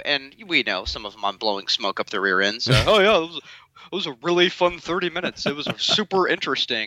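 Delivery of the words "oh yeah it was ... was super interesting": host mocking his polite guest praise, fake gush. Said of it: "I say this to everyone".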